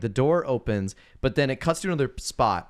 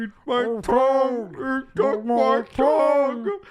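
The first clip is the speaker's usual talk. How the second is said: high, slow, drawn-out mock wail; tongueless victim; 5 dB louder, much slower than usual. Cohost playing the man who lost his tongue, wailing. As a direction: wail it with vowels stretched way out.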